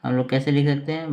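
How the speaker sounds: ordinary delivery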